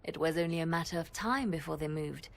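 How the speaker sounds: ordinary talk, nothing marked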